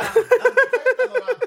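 laughing mock protest. Mock quitting the show, laughing.

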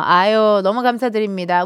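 ordinary talk

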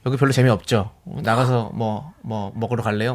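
low, soft, slow pick-up voice; cheesy smooth seducer. Acted. Man approaching woman in a club, cheesy low murmur. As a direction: play handsome, low and slow. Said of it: "너무 느끼해서".

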